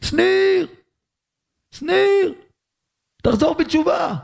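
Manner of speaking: high, far-off calling voice; mock heavenly shout; God calling out. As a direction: call down from the heavens. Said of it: "הוא צועק לנו".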